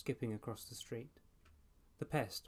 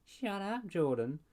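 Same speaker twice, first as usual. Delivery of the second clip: high mocking echo of her; mocking repeat; 8 semitones higher, 4 dB louder, slower than usual. A narrator's reading. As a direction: parrot her back to tease. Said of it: "Jordan repeated".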